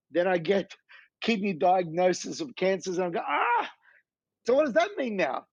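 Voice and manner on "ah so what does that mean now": shocked. Retold or imitated. Retelling his shock at the diagnosis.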